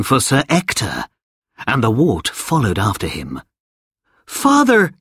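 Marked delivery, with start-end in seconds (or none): 4.3-4.9 a high, urgent call of "Father!"; cried out. A narrator's reading. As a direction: call across the crowd, startled. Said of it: "cried Sir Kay".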